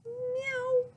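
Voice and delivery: squeaky high cat meow. Acted. Child pretending to be a cat, high meow. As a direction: mew like a little cat.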